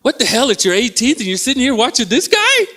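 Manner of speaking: high-pitched comic disbelief; high mock-outraged outburst; mock incredulous audience. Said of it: "you have all these people going".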